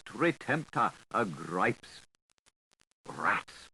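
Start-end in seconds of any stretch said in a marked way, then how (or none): none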